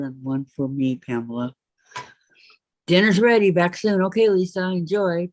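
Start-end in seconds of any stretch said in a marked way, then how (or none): 2.9-5.3 yelling across the room. Real, not acted. Reading comments aloud loudly.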